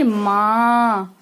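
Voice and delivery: माँ held very long with nasal vowel; drawn-out माँ. Shown as a demonstration. Teacher asking all children to say माँ, very long. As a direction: stretch माँ for the class to repeat. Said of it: "माँ बोलिए सारे बच्चे".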